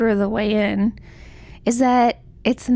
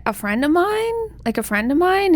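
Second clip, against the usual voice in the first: higher, rising tentative query; mock evasive question; 7 semitones higher than usual. Mother half-confesses to a priest, sheepishly evasive. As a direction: float it up as a flimsy cover story. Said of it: "then she's like".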